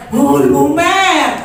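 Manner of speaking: loud slow swoon over favourite soup; delighted melting. Retold or imitated. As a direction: stretch it out like melting with joy.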